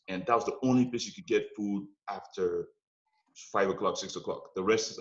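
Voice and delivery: normal talking voice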